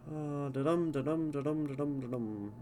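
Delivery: da-DUM chanted with a strong beat; rhythmic da-DUM demo chant; iambic da-dum rhythm. Shown as a demonstration. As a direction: tap out the iambic beat, weak then strong. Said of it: "This is referencing, like, Shakespeare sonnets".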